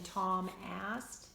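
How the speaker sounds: usual speaking voice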